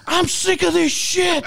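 high, slow, loud mock yell; kid snapping. Man retelling the kid's outburst in line, slow high yell. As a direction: drag it out like a boy at his limit. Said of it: "he says".

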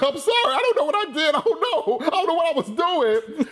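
high, fast, laughing mock sobs; mock crying. Eddie apologizing to Barbosa, high mock crying. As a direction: imitate a frightened man crying. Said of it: "just crying".

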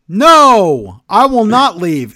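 loud, high hammed-up refusal; mock outraged protest; arguing defiantly. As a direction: dig in and yell it. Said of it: "Rhea's arguing with him".

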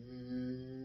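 long, low droning hum; sustained imitation of a humming vibration; imitated vibrating hum. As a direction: hold a buzzing "mm" like a vibration. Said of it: "loud vibrating".

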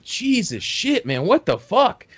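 high, drawn-out mock dismay; retold exasperation. Storyteller reacting to the fight, exasperated disbelief.

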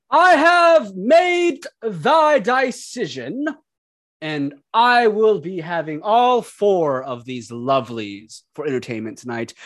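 high, slow, grandiose god voice; lordly and self-satisfied; god character voice. Acted.

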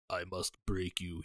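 low, cold and quiet like Drago; Drago line quoted. Retold or imitated. A hint of Drago, quiet and slow, quoting the villain line. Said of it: "most iconic villain lines".

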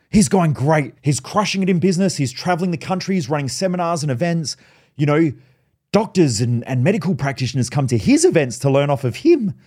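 proud mum. Retold or imitated. Mum tells everyone how her son is going, imitated proud gushing. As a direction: gush like a mum bragging about her son. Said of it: "she's so fucking proud of me".

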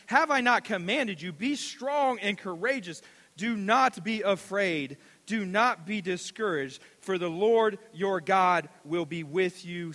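slow, loud, weighty verse; preacher declaring Joshua one nine; scripture reading. Acted. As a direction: proclaim the verse. Reads scripture.

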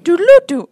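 high, loud synth blip; mouthed techno riff. Retold or imitated. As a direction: pop it out like a synth hook. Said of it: "Strong Bad from Homestar Runner".

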